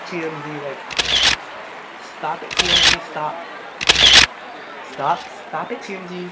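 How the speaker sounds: loud pretend star fending off cameras; mock celebrity protest. Embarrassed teen pretends TMZ is filming the arrest, mock loud protest. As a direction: shout it playfully at the paparazzi. Jokes she is hounded by TMZ.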